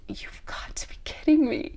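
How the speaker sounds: whispered disbelief; whispered you've got to be kidding me; incredulous whisper. Real, not acted. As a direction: breathe it out, half laughing. Incredulous aside after drama.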